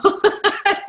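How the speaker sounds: high burst of giggles; high chuckling laugh. Real, not acted. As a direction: let out a high, relieved-sounding laugh. Laughs at end of talk.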